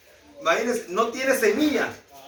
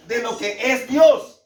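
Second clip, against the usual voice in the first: ordinary delivery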